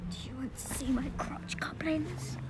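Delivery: whispered creepy offer. Retold or imitated. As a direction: hiss it like a secret. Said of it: "be like".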